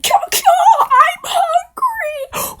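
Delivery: very high, drawn-out hungry screech; piercing vulture shriek. Acted. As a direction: caw loudly, then stretch the words shrilly. Vulture interrupts, cawing.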